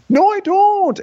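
very high, loud denial; hammed-up stubborn protest; mock defiant retort. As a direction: shriek it back like a sulking child.

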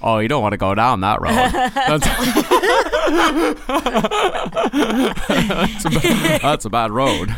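laughing through a mock Maine drawl; quick, giggly old-Maine warning; Maine accent. Teasing his wife at home in a mock Maine accent. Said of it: "phrases that I use to drive Carolina crazy".

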